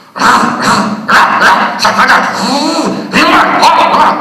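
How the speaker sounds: loud barked syllables ra ra sa sa; rhythmic diction drill. Shown as a demonstration. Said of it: "și începe".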